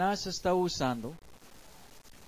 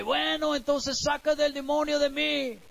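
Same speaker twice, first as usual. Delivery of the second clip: much higher, taunting voice; mocking neighbor; 8 semitones higher than usual. Rude neighbor taunts the pastor who won't turn, high and mocking. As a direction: jeer at the pastor.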